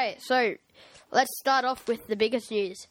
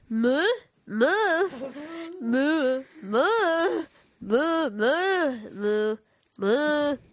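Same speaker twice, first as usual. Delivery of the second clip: repeated mooing in a mock Indian accent; Indian cow moo; 4 semitones higher, 3 dB louder, much slower than usual. Podcast host imitating an Indian cow, silly accented mooing. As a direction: moo over and over with an Indian lilt. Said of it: "An Indian cow".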